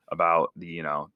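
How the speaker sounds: usual speaking voice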